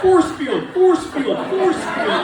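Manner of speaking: loud, high chanted Force field; rising comic shout, each Force punched. Pretend. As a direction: bellow force field like a kid with a shield. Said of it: "walk around going like this".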